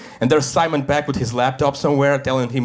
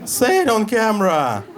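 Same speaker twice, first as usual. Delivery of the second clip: higher, slow, demanding cop voice; suspicious cop; 8 semitones higher, slower than usual. Retold or imitated. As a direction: play the undercover cop pouncing on the joke. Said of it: "And he was like".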